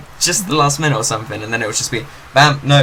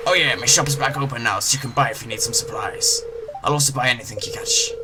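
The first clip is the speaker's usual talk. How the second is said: little pirate voice. Acted. Gamer voicing the fisherman greeting the newcomer as a pirate. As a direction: growl it out like a friendly old pirate. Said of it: "Little pirate voice".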